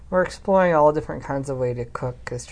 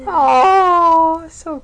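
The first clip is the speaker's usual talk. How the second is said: squeal; 13 semitones higher, 11 dB louder than usual. Real, not acted. Reacting to announcement.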